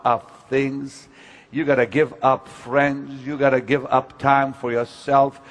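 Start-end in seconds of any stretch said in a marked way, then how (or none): none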